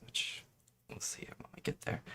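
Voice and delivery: quick unvoiced whisper. Real, not acted. Explaining kit pieces, trailing into a whisper. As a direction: hurry it under the breath. Trailing aside during building.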